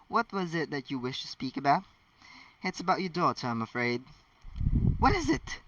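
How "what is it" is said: much higher, sharp alarmed demand. Acted. Hermione's mother demanding the professor explain, alarmed. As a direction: snap the question out in worry. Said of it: "Hermione's mother demanded".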